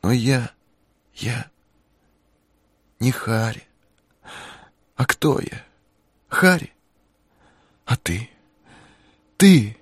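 high, wavering woman's voice; searching, lost; confused young woman. A narrator's reading.

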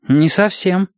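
put-on high-pitched puzzled child; unsure little boy. A narrator's reading. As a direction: admit it as a small boy. Boy admits he does not understand.

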